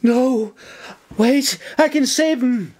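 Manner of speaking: high-pitched, panicked plea; narrator's acted shriek, pitched high; desperate shriek. A narrator's reading. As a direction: cry out in alarm to stop them. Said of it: "May shrieked".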